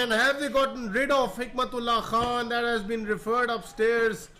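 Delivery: thrilled shout at the run out; excited shout. Real, not acted. Run out drama.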